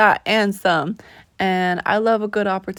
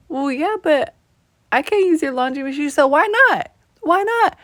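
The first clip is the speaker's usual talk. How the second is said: rising to a high, louder why not; playful insistence, pitch rising; insistent why not; 7 semitones higher, 4 dB louder than usual. Retold or imitated. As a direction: push the offer, climbing. Friend's insistent offer retold.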